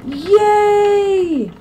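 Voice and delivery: high, loud cheer. Real, not acted. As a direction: shout for joy. Cheers after finishing.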